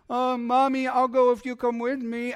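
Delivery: high, whiny mock-child voice; mock him as a pleading little wimp; wimpy mama's boy. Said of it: "what a wimp of a leader".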